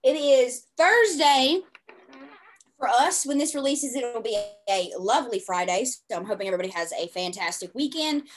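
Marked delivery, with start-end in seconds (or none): 0.0-5.8 upbeat announcer voice, loud and bright; like a radio DJ opening the show; radio host voice. Real, not acted. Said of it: "I sound like a radio host, announcer".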